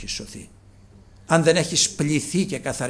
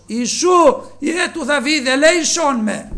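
higher, louder plea to Jesus; crying out for mercy; 13 semitones higher, 12 dB louder, slower than usual. Retold or imitated. Blind Bartimaeus crying to Jesus, loud plea. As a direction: call out like a blind beggar begging. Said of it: "πολλό μάλλον έκραζε".

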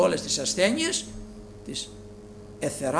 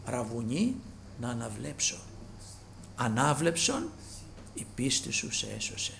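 soft retelling; 5 semitones lower, 9 dB quieter than usual. Acted. Dialogue repeated softly.

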